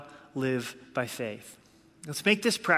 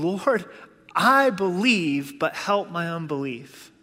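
loud, drawn-out plea, easing at the end; imitated crying out; 3 semitones higher, 6 dB louder, slower than usual. Father begging Jesus to help his unbelief, crying out loudly. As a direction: act out a desperate cry to the Lord. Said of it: "the father responded immediately, crying".

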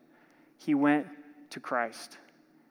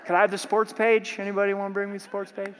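joking call-out with a laugh, higher and louder; teasing; 4 semitones higher, 4 dB louder, faster than usual. Acted. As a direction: tease the congregation playfully. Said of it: "I'm just having fun with you".

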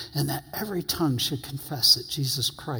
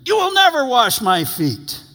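blurted refusal; 9 semitones higher, 7 dB louder than usual. Retold or imitated. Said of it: "Peter then speaks without thinking".

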